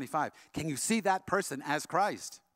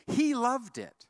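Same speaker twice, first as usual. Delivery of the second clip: delighted exclaim; 10 semitones higher, 6 dB louder, much slower than usual. Real, not acted. Punchline of the letter story.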